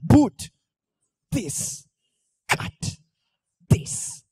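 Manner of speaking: "Put. This. Cut. This." as separate drum hits; explosive "Put", then voiceless "This", "Cut", "This"; beatbox pronunciation. Shown as a demonstration. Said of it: "now say it with me".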